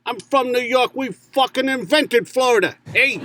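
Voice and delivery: high, brash New Yorker; New York accent. Acted. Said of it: "he's a pro. His voices".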